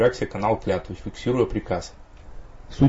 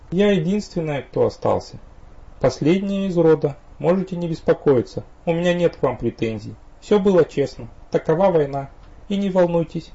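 higher, lighter female character voice; young woman voice; 7 semitones higher than usual. A narrator's reading. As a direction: voice the vampire girl calmly. Viriena's voice held through her lines.

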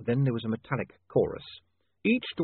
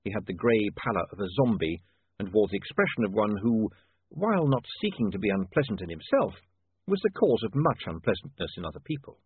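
ordinary delivery, nothing marked

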